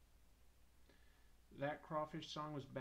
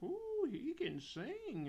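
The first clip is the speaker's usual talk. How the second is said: high, slow swooning gush; high-pitched mock-impressed fans; impressed audience; 12 semitones higher, much slower than usual. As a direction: coo in mock amazement like a crowd. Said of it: "everyone's like".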